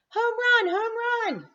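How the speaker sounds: very high, loud triumphant shout; shouted home run. Acted. Froggy shouting home run on the plate, high and loud. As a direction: yell it in victory. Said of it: "he shouted".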